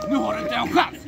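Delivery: playful shouted boast at Einar; shouted taunt. Pretend. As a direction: crow it at your rival. Loud teasing taunt.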